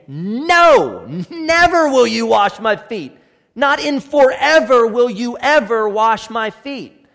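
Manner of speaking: loud, high, forceful refusal, never punched; preacher voicing Peter refusing Jesus, loud emphatic no. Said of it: "it's a double negative, meaning that it's emphatic".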